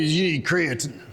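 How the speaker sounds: mildly put-on salesman accent; mock supplement-shop guy accent; Chet accent. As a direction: lightly caricature a store clerk's accent. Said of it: "have to talk in this accent when I do Chet".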